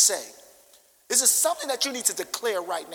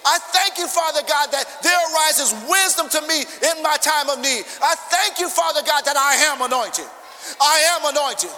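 louder, higher fervent declarations; full-force preaching; 4 semitones higher, 5 dB louder than usual. Acted. Pastor declaring blessings over the church, fervent. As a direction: proclaim like a fiery preacher.

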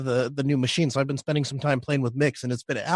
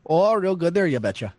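put-on North Dakota accent. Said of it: "I had to do an accent".

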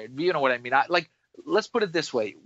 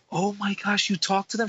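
high, wide-eyed gasp; starstruck colleague; 6 semitones higher than usual. Retold or imitated. Colleague gasping at a message from celebrities, high and amazed. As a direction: play her amazed and starstruck. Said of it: "she goes".

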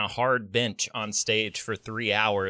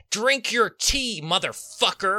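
imitated yell; 9 semitones higher, 3 dB louder than usual. Retelling his teacher's order, a mock yell high and loud. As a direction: yell it like a bossy acting teacher. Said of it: "that man yelled at me".

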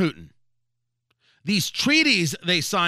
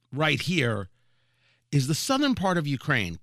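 ordinary talk

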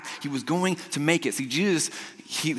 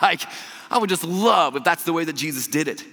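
usual speaking voice

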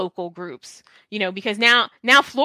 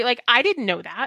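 ordinary delivery

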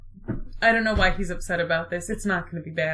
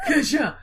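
mock stab noise; 4 semitones higher than usual. Mock stab sound effect.

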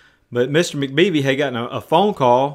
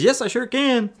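high, jaunty reply; cheerful confirmation; 10 semitones higher than usual. Retold or imitated. Man from the trees answering the father, cheerfully. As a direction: imitate an obliging, upbeat stranger.

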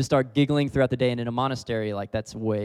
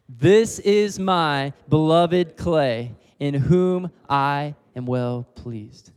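loud slow declaration; 2 semitones higher, 5 dB louder, much slower than usual. Shown as a demonstration. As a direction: proclaim it aloud to the whole room. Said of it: "One more time".

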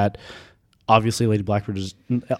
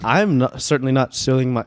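normal talking voice

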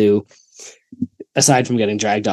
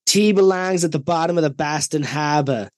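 higher-pitched mock Boston accent; 4 semitones higher than usual. Friend announces an impression of a teammate, mock Boston accent. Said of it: "say this one in Matt's accent".

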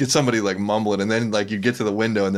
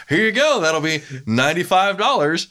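high, louder chipper service voice, laughing; fake-cheery barista; 10 semitones higher, 4 dB louder than usual. Recalling coffee job, chipper barista voice. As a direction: hand it over with fake brightness. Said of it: "I'd be like".

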